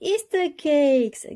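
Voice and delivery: high, slow gasp of delight. A narrator's reading. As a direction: breathe it out in wonder. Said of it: "gasped Peppa".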